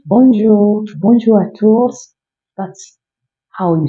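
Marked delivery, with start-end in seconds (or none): 0.1-2.2 higher, slow bonjour à tous; cheerful greeting. Shown as a demonstration. Youtuber greeting viewers with a slow bonjour. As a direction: greet the whole room with bonjour. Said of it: "I always tell you guys".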